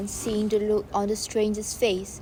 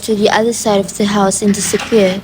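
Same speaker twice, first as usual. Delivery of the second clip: much louder, projected narration; 18 dB louder than usual. Acted. Narrator reads the crowd's rush to the house, loudly projected. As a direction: boom it out over the crowd. Narrator shouting stage directions.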